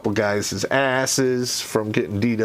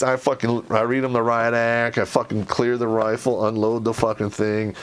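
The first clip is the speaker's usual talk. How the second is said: ordinary talk, nothing marked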